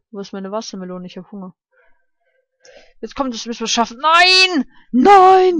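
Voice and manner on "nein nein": loud high cry of no; sharp loud frustrated no; frustrated shout. Real, not acted. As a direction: yell it out as the ball misses. Fails the level, cries out.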